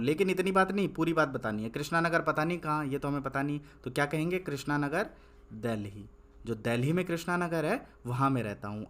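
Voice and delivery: ordinary talk, nothing marked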